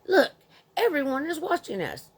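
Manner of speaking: mock angry mother. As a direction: nag the child to stop making a scene. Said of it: "this woman was getting on my nerves".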